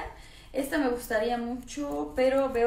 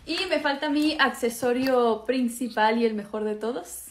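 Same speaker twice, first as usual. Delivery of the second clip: ordinary delivery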